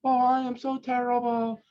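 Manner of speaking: high, whiny mock grovel; over-the-top groveling apology. Shown as a demonstration. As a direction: plead pathetically, hamming it up. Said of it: "you don't have to grovel".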